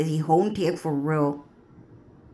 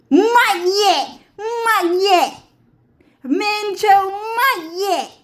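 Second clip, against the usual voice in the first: very high, loud, crying cry of "not yet"; begging through tears, voice cracking high; a loud, high, tearful wail; desperate crying plea; 14 semitones higher, 6 dB louder, much slower than usual. Acted. Acted figure crying out desperately.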